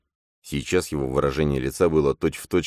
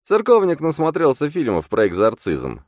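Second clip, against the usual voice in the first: much higher, louder sneering imp; a shrill inner double mocking; 12 semitones higher, 6 dB louder than usual. A narrator's reading. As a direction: butt in with a jeer. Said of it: "вставил Фантом".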